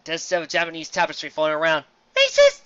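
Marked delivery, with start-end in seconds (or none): 2.2-2.6 very high shrieking yell; mock outrage. Reviewer mock-accuses the story, very high loud shriek. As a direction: scream the accusation in fake indignation.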